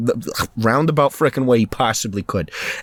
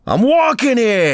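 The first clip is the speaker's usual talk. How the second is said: high, drawn-out mock yell; New York catchphrase shout; 12 semitones higher, 4 dB louder than usual. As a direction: holler it as a hammed-up New Yorker. Hamming up stereotypical New York phrase.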